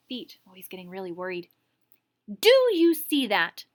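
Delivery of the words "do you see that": high, loud, slow demand; angry demand. Acted. Teacher pointing at the spitball, slow loud demand. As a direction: press each word at him. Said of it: "the teacher demanded".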